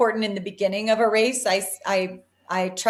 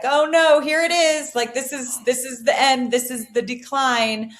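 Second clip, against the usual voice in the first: higher-pitched, mock-panicked self-talk; mock dread; 4 semitones higher than usual. Runner recalling her early reaction to discomfort, mock dread. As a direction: act out your old race panic, voice lifted. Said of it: "when I got uncomfortable, I would be like".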